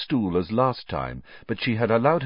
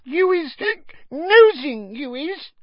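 very high, tipsy squeak; drunken little elf, punching the accusation; accusing elf; 5 dB louder, slower than usual. A narrator's reading. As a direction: accuse him between hiccups. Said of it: "frowning up at Harry".

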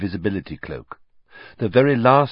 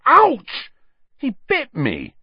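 sharp yelp, much higher and louder; ouch; 6 dB louder, slower than usual. A narrator's reading. Ron yelping as Scabbers bites him. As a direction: yelp in sudden pain. Bitten by the rat.

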